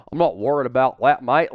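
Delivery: put-on light Australian accent on mate; brief imitation of an Australian player; a hint of Aussie accent, mate said as mite; mock Aussie accent. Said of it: "the Aussie accent for Jordan Mailata".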